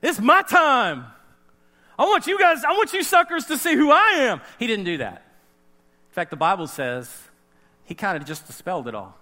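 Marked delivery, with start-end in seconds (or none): 0.0-4.4 louder, higher swaggering claim; boastful proclamation. Retold or imitated. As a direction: crow about finally getting his moment. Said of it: "Jesus would be like".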